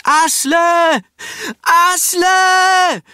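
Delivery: very high, full-volume cry against the waves; desperate shout. Acted. Brita swimming through the waves shouting for Asle, desperate shout. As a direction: shout his name with all your strength. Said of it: "sie ruft".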